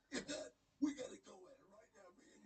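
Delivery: Macho Man impression. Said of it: "He goes".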